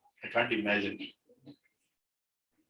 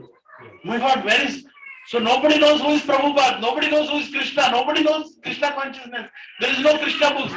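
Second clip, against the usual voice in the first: distraught; 9 semitones higher, 5 dB louder than usual. Retold or imitated. Dreamer weeping over lost temple.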